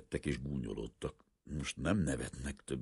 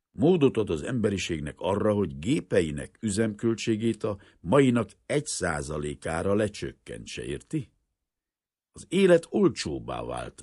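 impassioned explanation; 3 semitones higher, 7 dB louder than usual. A narrator's reading. Professor tells his assistant of his life's discovery, impassioned and loud. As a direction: press each point home with swelling volume.

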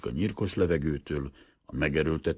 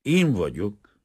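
surprised answer; 5 semitones higher, 5 dB louder, slower than usual. A narrator's reading. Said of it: "felelte a professzor csodálkozva".

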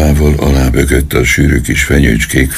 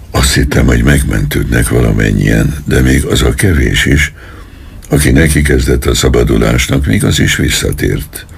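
ordinary delivery

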